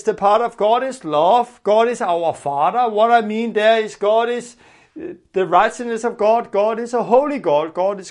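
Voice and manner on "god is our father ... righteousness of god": ordinary delivery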